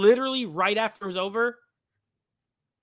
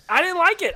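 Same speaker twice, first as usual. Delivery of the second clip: "like" hit high and loud, "I didn't like it" pushed up hard; louder emphatic repeat; 10 semitones higher, 7 dB louder than usual. Real, not acted. Friend insisting to Alex that he didn't enjoy the remake, loud emphatic. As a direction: insist on it, punching "like". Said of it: "repeated, stronger".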